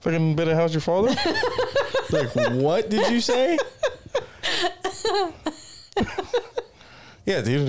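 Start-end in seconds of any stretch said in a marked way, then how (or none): 0.6-3.6 words through laughter; laughing. Real, not acted. Laughing at phrase. 3.6-5.5 squealing cackle; high-pitched laugh. Real, not acted. Laughing hard.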